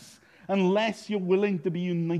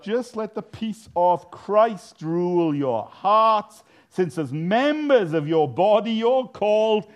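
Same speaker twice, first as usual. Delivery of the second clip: slow, louder, every word weighted; slow emphatic scripture; 3 semitones higher, 7 dB louder, slower than usual. Real, not acted. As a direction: lay each word down firmly. Preacher quotes scripture emphatically.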